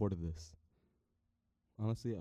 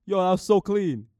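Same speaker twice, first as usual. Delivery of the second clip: high, loud excited cheer; loud, high self-congratulation; elated hype; 10 semitones higher, 14 dB louder than usual. Real, not acted. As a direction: shout it, thrilled with yourself. Elated after his big shout.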